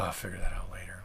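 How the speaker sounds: whispered, tired aside. Real, not acted. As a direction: whisper it to yourself. Whispered repeat to himself.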